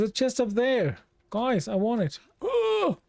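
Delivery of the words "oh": sharp high yelp of surprise; a sudden delighted cry, pitched way up; surprised oh. Real, not acted. Spots chest, sudden exclamation.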